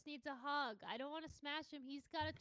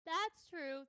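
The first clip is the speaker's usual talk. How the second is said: higher, louder agreement; emphatic agreement; 5 semitones higher, 6 dB louder than usual. Real, not acted. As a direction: agree with gusto.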